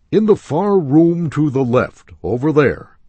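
high, loud call across the store; salesman pointing. Acted.

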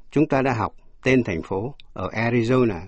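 ordinary talk

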